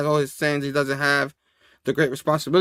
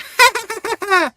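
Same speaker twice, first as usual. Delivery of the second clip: high-pitched maniacal cackle; rapid, high hammed-up villain laugh. Goblin voice cheering the beating, maniacal cackle.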